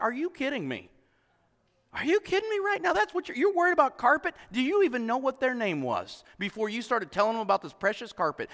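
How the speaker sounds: high, disbelieving rising questions; aghast at the carpet worry; incredulous. Retold or imitated. Pastor retelling his outrage at the carpet people, incredulous. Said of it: "I'm like".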